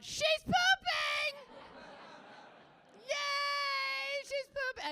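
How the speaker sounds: put-on high, loud, slow delighted child's yell; toddler screaming. Daughter announcing the neighbor in the stall, high loud yell. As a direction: shriek it like a thrilled three-year-old. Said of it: "at full volume, screamed out".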